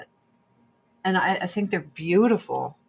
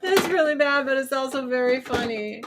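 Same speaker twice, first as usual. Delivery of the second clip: higher, amused restatement of the joke; amused repeat; 6 semitones higher than usual. Real, not acted. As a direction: say it again, still giggling a bit. Repeats own line, amused.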